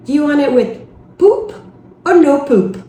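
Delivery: poop said with a long oo like the word for poo; mispronounced pulp as poop. Retold or imitated. Wife asking husband across supermarket, saying poop for pulp. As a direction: say poop instead of pulp, puzzled shopper.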